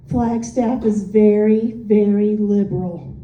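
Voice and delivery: low, slow, weighty verdict; slow and low, each "very" weighed; mimicked disapproval. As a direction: imitate a wary stranger, dropping the pitch. Retelling stranger's disapproving verdict.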